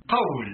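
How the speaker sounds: قول as qawl or qūl, waw unvowelled; vowelless waw. Shown as a demonstration. Reader quotes the tribes' قول, with vowelless waw. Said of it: "بواو ساكنة".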